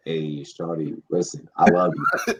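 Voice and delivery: low, soft sweet-talking the wife; hammed-up soothing to the wife; mock tender. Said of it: "I'd be like".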